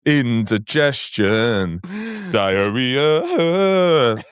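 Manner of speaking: slow, grand, hammed-up actor's read; milk each word like King Lear on stage; theatrical advert actor. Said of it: "went to drama school to play King Lear".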